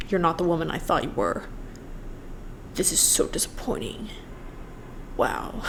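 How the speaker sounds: hushed, half-whispered mock of a wounded man; mocking the boyfriend. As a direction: read his tweet like a breathy, wounded sigh. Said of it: "he fucking tweeted some stuff that was like".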